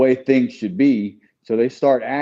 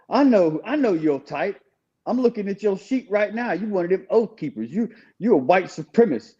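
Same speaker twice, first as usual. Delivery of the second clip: higher, knowing accusatory voice; imitating smug chaplain; 7 semitones higher than usual. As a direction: sneer it like you've read his file. Retold chaplain accusing him.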